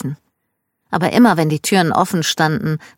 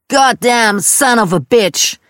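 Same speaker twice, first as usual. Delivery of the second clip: slow, raised, drawn-out cursing; angry yell; 6 semitones higher, 5 dB louder, slower than usual. A narrator's reading. Dad battling something among the stuffed animals, slow angry yell. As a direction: yell each word of the curse, pitched high. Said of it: "Dad schrie".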